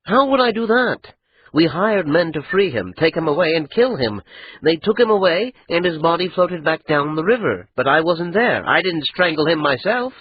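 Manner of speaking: put-on a young lord's voice, tense and insistent; Adra, defensive. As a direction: press back at her accusation. Adra answering Ida'an.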